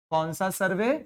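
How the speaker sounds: normal talking voice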